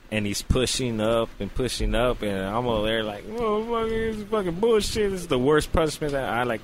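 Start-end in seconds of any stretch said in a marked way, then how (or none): 3.3-6.6 much higher, outraged complaint; hammed-up pained outrage; pained protest. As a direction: protest as if in pain. Retold protest during painful exam.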